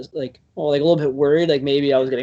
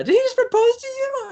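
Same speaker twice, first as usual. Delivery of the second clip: mimicking woman. Passerby woman calls to the couple, squealing falsetto. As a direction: play the stranger, squealing with delight. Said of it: "this woman yells".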